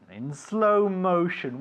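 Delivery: slow, lengthened vowels; slow motion. Shown as a demonstration. Teacher repeats the new phrase, in slow motion. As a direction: stretch the words out for a learner. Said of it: "I will say things in slow motion".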